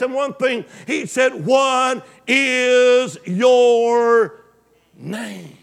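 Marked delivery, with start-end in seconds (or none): 1.5-5.5 God asking Jacob his name, slow and weighty; slow drawn question. Acted. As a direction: stretch the question out, then let "name" fall away.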